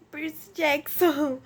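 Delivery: normal talking voice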